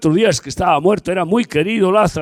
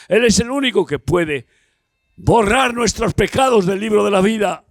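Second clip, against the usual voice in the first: forceful preaching; 4 semitones higher than usual. Acted. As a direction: drive home the one way to heaven. Preacher insists on one way.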